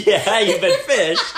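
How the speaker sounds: high, slow laughing words. Real, not acted. Laughing at the story.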